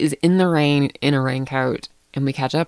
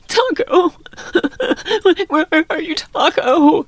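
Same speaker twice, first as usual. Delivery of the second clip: imitating a crying girl calling, much higher and louder; tearful calling; 5 dB louder, slower than usual. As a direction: wail for the lost dog through tears. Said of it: "Marlo is screaming".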